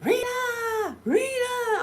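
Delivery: high, drawn-out call of her name; imitating a husband calling his wife. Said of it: "he like".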